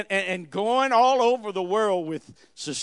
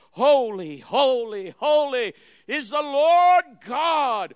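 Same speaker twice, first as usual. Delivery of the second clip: loud, raised, slow holy; cried out; 6 semitones higher, 6 dB louder, slower than usual. Acted. Preacher voicing seraphim crying before the throne, loud. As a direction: cry it out like the seraphim. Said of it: "they cried".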